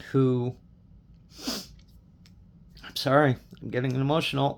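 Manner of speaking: fighting back tears while talking about his dad; choked up. Real, not acted. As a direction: voice catching as tears come, real. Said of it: "I'm gonna get emotional".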